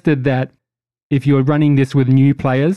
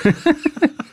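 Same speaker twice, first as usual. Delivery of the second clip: high bursting laugh; 14 semitones higher than usual. Real, not acted. Laughing at Marine joke.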